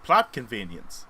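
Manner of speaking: slow, dry deadpan; a hint of a 1940s announcer. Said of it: "announcer man on as, like, Nick Fury".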